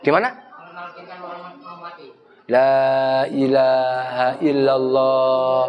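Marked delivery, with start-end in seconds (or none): 2.5-5.6 slow and gentle. Shown as a demonstration. Preacher showing how to recite dzikir, very slow and calm. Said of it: "Begitu, pelan-pelan".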